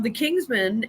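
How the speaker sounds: normal talking voice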